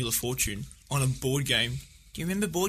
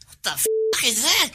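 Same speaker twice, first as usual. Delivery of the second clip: put-on shocked drunk bloke; 8 semitones higher than usual.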